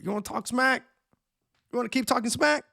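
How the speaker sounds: mock challenge. Acting out someone who rushes at you, taunting challenge. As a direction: throw it out like someone picking a fight.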